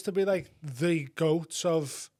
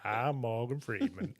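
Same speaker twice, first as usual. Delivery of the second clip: Morgan Freeman impression; 5 semitones lower, 7 dB quieter than usual. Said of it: "Do it".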